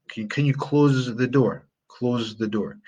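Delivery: "close" ending in a buzzed z; z sound in close. Shown as a demonstration. Teacher showing the verb, "close" with a z. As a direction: lean on the z in "close". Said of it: "That's with a z sound".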